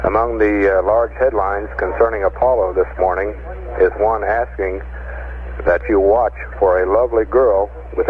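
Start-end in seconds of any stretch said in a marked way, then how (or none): none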